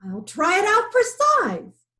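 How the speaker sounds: high, loud showy lion voice; pleased lion. A narrator's reading. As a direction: boast like a greedy lion. Lion speaks, amused.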